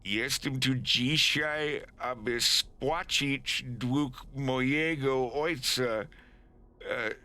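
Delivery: halting read-aloud in anglicized Polish; nervous appeal. Acted. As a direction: deliver a careful formal plea, word by word. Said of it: "Wolf swallows, takes a breath".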